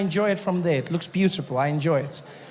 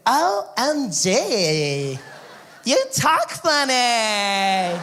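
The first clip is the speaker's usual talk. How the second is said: put-on high, slow airy California girl; valley girl voice; 5 semitones higher, much slower than usual. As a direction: play the ditzy Malibu surfer girl. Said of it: "She's like".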